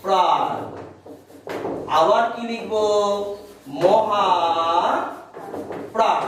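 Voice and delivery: each word spaced out for writing; slow dictation. Shown as a demonstration. Teacher dictating to pupils, slow and spaced. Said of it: "আবার লিখবো".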